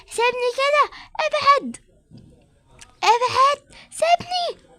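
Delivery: a suspect shrieking as the police grab her; screaming to be let go. Acted. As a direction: fight them off, shouting. Arrested woman resists police.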